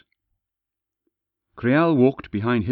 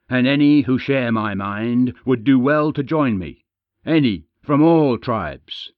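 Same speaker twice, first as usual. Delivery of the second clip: rallying call; 3 semitones higher, 6 dB louder than usual. Acted.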